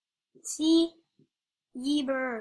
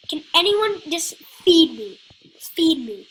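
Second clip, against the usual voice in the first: loud plea; 3 semitones higher, 8 dB louder than usual. Real, not acted. Begging players for food.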